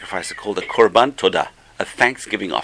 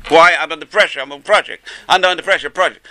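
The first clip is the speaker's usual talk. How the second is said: higher-pitched mock rant; retold ranting; 6 semitones higher, 6 dB louder than usual. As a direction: imitate a stressed husband raving at his wife. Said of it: "he was screaming and ranting and raving at her".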